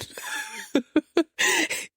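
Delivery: high, light burst of giggles; real high-pitched tittering laugh. Real, not acted. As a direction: giggle helplessly at your own understatement. Laughing at her own understatement.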